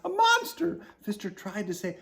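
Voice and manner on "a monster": high, loud screech of fright. Acted. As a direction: screech it in panic. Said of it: "took one look and screeched".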